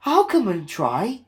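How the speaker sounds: high, willing little engine; eager Edward. A narrator's reading. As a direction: offer gladly. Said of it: "he said".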